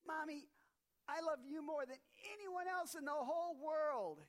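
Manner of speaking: put-on high eager kid voice; child's voice. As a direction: play the sweet little boy hugging mom. Child Johnny voiced in story.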